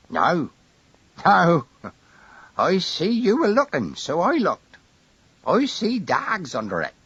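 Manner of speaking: put-on high piping old countryman; a tiny old voice, eager; old man voice. A narrator's reading. Old Harry answers.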